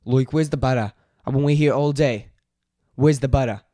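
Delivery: very loud Aussie complaint; exasperated, hammed-up Australian accent. Shown as a demonstration. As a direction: shout it in amateur Australian. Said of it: "let's try Australian".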